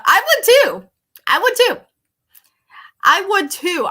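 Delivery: high, loud insistent agreement; I would too, pressing hard; emphatic. Real, not acted.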